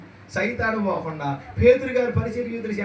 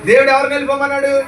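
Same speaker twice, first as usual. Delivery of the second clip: louder and faster, pressing the crowd; loud demanding question; 4 semitones higher, 8 dB louder, faster than usual. Acted. Preacher pressing the congregation for an answer, loud and fast. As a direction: hammer the question at them, quick and loud.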